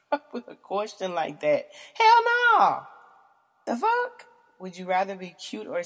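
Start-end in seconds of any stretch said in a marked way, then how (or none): 1.9-4.2 high, loud shriek of disbelief; outraged shriek. Real, not acted. Answering a weird question with a high outraged shriek.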